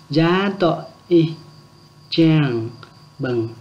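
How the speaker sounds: Gia tọ y chẳng bừng split into slow, separate chunks; slow it right down for the class to repeat. Shown as a demonstration. Teacher repeating the phrase slowly for learners to copy. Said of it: "Lại nhé".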